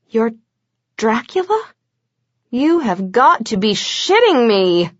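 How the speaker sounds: can't believe it, half laughing; stunned disbelief at meeting Dracula; flabbergasted. A narrator's reading. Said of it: "being flabbergasted".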